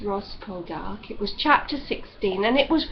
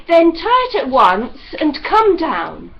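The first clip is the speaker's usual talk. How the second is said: slow, loud commanding call; stern loud order; 5 semitones higher, 9 dB louder than usual. A narrator's reading. Ross ordering Demelza down at once, slow and loud. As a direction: lay down the law from below.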